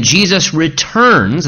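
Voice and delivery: slow, with returns leaned on and rising; stress on returns. Shown as a demonstration. Pastor stressing returns to his congregation, slow and weighty. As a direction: lean into the word returns, drawn out. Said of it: "I emphasize the word returns".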